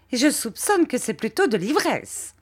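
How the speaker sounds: high, snide insinuation; high prim lady, snidely suspicious; sneering Miss Murdstone. A narrator's reading. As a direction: add the jab with prim spite. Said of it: "dit miss Murdstone".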